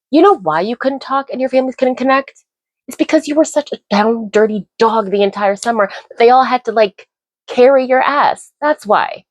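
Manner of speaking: high, indignant scolding; exasperated rant. Real, not acted. Vlogger calling out Sincere's excuse, high and indignant. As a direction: tell him off, incredulous.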